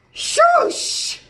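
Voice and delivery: sharp, high, loud shush. Acted. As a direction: hush him abruptly. Telling koala to be quiet.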